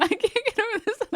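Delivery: high, fast, laughing repeat. Real, not acted. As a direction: rattle it off mid-laugh.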